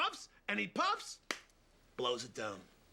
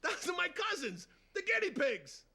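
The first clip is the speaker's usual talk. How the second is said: proud mobster; 11 semitones higher than usual. Acted. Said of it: "And Fredo goes".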